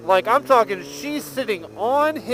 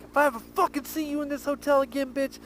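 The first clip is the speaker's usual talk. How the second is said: flipping out; 7 semitones higher than usual. Retold or imitated. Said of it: "flipped out and was like".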